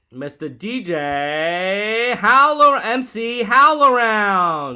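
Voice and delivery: slow, loud showman announcement; announcer intro. Acted. Host introduces his rapper friend with loud slow announcer hype. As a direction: hype up the guest like a ring announcer.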